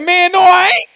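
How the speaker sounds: high, shouted mock protest; indignant high-pitched outburst; excited denial. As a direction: play Peter getting worked up and denying it. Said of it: "he got a little more excited".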